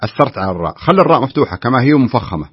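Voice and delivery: ordinary talk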